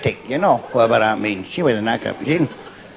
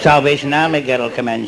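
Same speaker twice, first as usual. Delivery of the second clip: ordinary delivery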